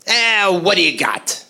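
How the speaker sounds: slow, offhand customer drawl; slow gruff customer. Retold or imitated. Customer asking the bartender, in a slow offhand drawl. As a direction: lean in, lazily asking what's on tap.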